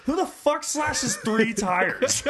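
high, loud, slow disbelief; incredulous outburst. Retold or imitated. Buddy reacting to the slashed tires, incredulous. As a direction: stretch it out, loudly baffled.

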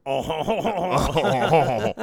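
loud, high drawn-out mock laugh; imitated laugh. Imitating French Canadian movie characters laughing, loud and hammed-up. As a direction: bellow a long exaggerated laugh. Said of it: "they laugh like".

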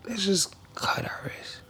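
a slow, breathless whisper of a tempting inner voice; whispered dark thought. Retold or imitated. Teen alone in her room hearing a whispered urge.

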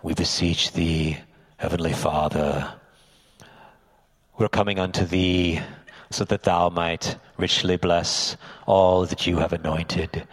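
low, loud mock-pious intoning; pompous parody of a church prayer; put-on prayer voice. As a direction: pray grandly in a deep show voice. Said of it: "and the voice would change".

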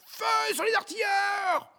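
long, high-pitched shouted order to fire; commander's cry. Acted. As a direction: shout the order, drawing out the words. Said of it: "et il cria".

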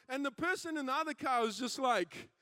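ordinary delivery

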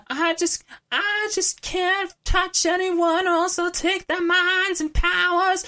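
very high, slow, whiny lament; emo voice. Retold or imitated. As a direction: wail it like a tragic teen. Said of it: "anytime that Rogue's like".

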